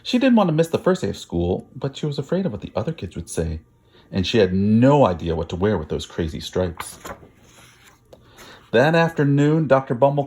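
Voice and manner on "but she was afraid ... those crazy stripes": ordinary delivery, nothing marked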